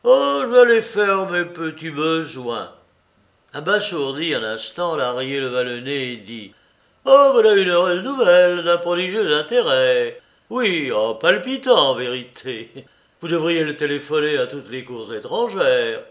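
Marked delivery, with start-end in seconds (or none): 0.0-2.7 vieux bonhomme un peu fort, content de lui; voix de Soupe. Acted. Soupe voiced, annonce ses besoins. 7.0-16.0 moqueur et plus fort, ironie grinçante; raille-le avec emphase; sarcasme appuyé. Acted. Larrier ironise, voix jouée.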